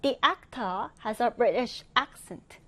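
careful British-accented demo reading. Shown as a demonstration. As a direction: read it in a British accent, dropping the final R. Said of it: "영국식 억양으로 한번 읽어본다면".

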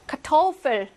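카토펠 with 토 pushed out strongly; stress on middle syllable. Shown as a demonstration. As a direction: put the weight on 토 in 카토펠. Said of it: "강세가 가운데 옵니다". (카토펠의 가운데 '토'에 강세)

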